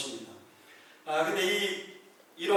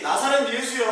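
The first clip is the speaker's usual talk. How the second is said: 나사렛 예수여 called out high and loud, a grab for control; loud naming; 7 semitones higher, 7 dB louder than usual. Acted. Demon calling out Jesus's name to control him, loud and high. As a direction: shout 나사렛 예수여 as if the name gives power. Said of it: "하고 이름을 부르는 것은요".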